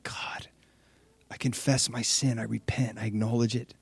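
soft, breathy confession; hushed confession. Retold or imitated. Sinner confessing before God, hushed and soft. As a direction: whisper it to God.

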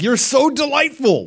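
gushing. Pretend. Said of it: "You're like".

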